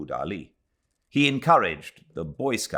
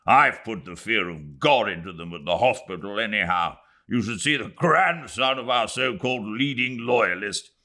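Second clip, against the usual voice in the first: blustering major; 4 semitones higher, 4 dB louder than usual. Acted. Major Callender boasting to the club, loud gloating. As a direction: brag with relish.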